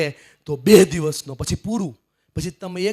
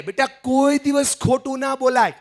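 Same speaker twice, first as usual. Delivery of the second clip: father's lecture; 9 semitones higher than usual. Retold or imitated. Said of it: "પપ્પા કે".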